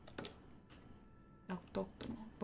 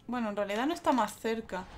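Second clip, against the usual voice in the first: ordinary talk, nothing marked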